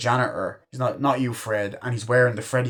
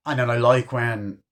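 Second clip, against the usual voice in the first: normal talking voice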